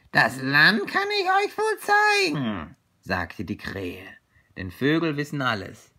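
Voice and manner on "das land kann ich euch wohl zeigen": very high, loud crow screech. Acted. Crow offering to show the friends the land, high crow voice. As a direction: screech it as a know-all crow. Crow's voice in the story.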